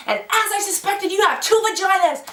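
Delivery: hammed-up triumphant announcement; doctor's grand reveal. Doctor's dramatic revelation, acted out.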